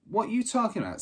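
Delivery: indignant disbelief. Acted. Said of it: "said Benny".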